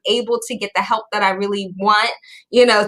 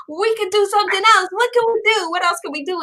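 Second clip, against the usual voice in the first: much higher, fast and eager; eager big kid; 8 semitones higher, faster than usual. Retold or imitated. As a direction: pitch up like a kid begging for fun. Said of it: "I wanna always be like".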